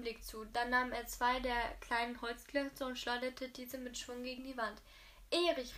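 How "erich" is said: schockiert laut rufen; entsetzter Ruf. A narrator's reading. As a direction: ruf seinen Namen erschrocken aus. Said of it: "rief Inge entsetzt". (entsetzt und laut: Erich)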